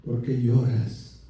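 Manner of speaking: low, slow, very soft question; hushed gentle question. Retold or imitated. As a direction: ask it slowly, barely above a whisper. Voices Jesus speaking to Mary.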